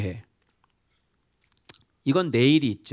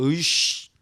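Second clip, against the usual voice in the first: annoyed grunt; 4 semitones higher, slower than usual. Retold or imitated. Voicing an annoyed relative.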